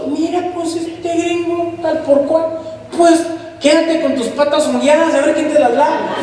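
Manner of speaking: high, sneering mock voice of an offended local; mocking scornful retort. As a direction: scoff at the foreigner in a high, taunting voice. Mocking how we'd answer gringo.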